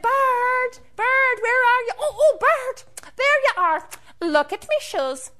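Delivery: high, loud calling out; excited leprechaun. Acted. Leprechaun running to the lake to find bird, excited.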